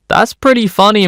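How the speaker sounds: high-pitched, teasing admission; mock conceding. Narrator wavering over a theft story, mock conceding. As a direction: say it high, as a playful confession. Torn between disapproval and amusement.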